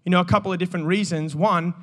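normal talking voice

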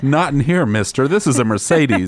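high, prim robot scolding; fussy droid voice, up high. Retold or imitated. Voicing the robot Dot.

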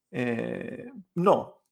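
hesitant answer after a drawn-out "Eh"; doubtful. Pretend. Answering a cinema invitation, acted hesitation. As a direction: pretend to be unsure of the reply. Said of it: "Es como si dudaras".

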